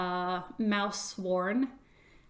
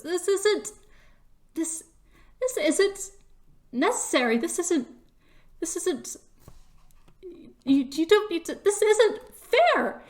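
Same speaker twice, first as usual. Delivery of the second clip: high, halting, building to a louder outburst; frustrated stammer breaking out on the last word; upset protest; 9 semitones higher, 4 dB louder than usual. Acted. Chris protests disqualification, upset and building in force. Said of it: "like, growls and goes".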